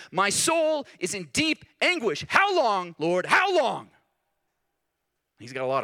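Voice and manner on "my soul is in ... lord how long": slow, high, loud lament; David lamenting. Retold or imitated. Preacher reading David's psalm to God, slow high lament. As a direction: draw out the anguish, pleading. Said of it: "he writes in Psalm six three".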